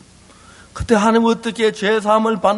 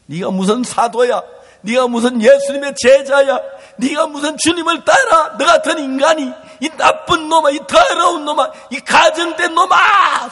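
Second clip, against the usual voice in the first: inner shout; 6 semitones higher, 3 dB louder than usual. Acted. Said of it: "소리가 막 소리 지르는 거예요".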